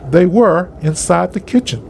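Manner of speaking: ordinary delivery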